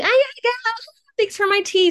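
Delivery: very high, squeaky thank-you; high-pitched, flustered acceptance; awkward thanks. Retold or imitated. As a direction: imitate yourself fleeing politely. Awkwardly accepting stranger's drink.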